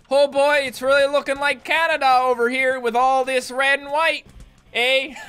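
loud, hammed-up Canadian accent. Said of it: "Even with the Canadian accent".

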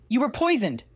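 sharp, high-pitched outburst. A narrator's reading. Man tells waking friend of poisoning, sharp. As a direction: blurt it out sharply, pitch jumping up. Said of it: "came out sharper than he'd meant".

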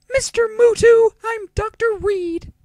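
loud, slow falsetto; put-on female doctor. Doctor seeking Yugi's guardian, loud slow falsetto. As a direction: call out slowly in a very high woman's voice. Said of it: "I have very few female voices".

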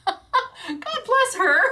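slow, high laughing blessing. Real, not acted. Owner laughing about her generous boss. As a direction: laugh it out fondly.

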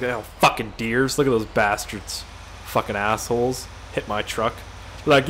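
ordinary talk

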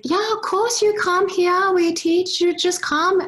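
put-on high Chinese-accented woman urging her to come. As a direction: imitate the Chinese lady on the phone, Chinese accent. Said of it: "I butchered that Chinese accent".

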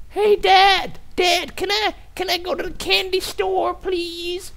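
put-on high whiny kid begging. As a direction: beg like a little kid. Voicing the kid begging dad.

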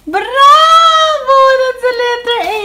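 high, loud, drawn-out cheer; cheering praise. Real, not acted. Parent praising toddler for finding the letter A, cheering.

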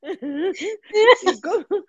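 words stretched out through laughter; laughing while talking. Real, not acted. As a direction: ask while cracking up. Laughing while watching the video. (riendo a carcajadas)

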